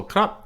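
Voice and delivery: "krap" pitched high; high-pitched polite "krap". Shown as a demonstration. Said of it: "high tone".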